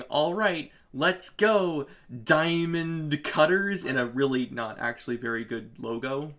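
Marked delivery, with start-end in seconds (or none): 0.0-3.8 loud, raised rallying cry; Lanolin's declaration. Acted. Team leader charging forth with the squad, mock-heroic declaration. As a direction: declare it big, drawing out the team name. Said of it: "with Lanolin declaring".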